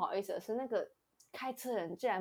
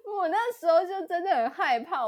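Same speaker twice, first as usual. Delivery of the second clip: laughing nervously; 9 semitones higher, 7 dB louder than usual. Real, not acted.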